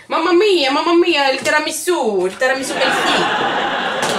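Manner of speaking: loud, high, hammed-up Italian accent; over-the-top mock Italian, breaking into laughter. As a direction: belt it out like a caricature Italian. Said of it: "je devrais prendre l'accent italien".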